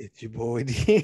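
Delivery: slow smooth DJ intro; lightly voiced late night DJ; late night radio voice. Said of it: "late night radio voice".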